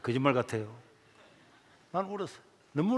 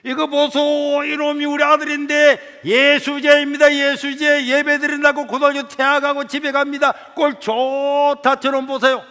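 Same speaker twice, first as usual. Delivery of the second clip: high, loud public shaming; loud mocking mother; 8 semitones higher, 11 dB louder, slower than usual. Mother shames her son to passengers on the bus, loud. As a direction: call out to the whole bus.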